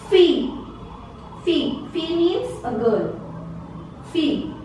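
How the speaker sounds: fille said fee, no L; ILLE as ee. Shown as a demonstration. Teacher showing ILLE sounds like ee in fille. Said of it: "the sound E".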